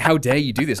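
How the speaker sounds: a hammed-up scandalized reproach; mock indignation. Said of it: "he was like".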